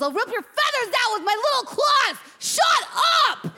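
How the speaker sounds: high-pitched, raging shout; angry yelling. Acted. Hamster yelling at the birds in the cage, furious shout. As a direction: scream threats at full pitch.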